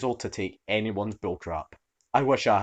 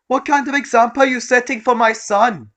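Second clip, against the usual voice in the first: indignant shrill shout; 13 semitones higher, 11 dB louder than usual. Acted. Entitled mum ranting.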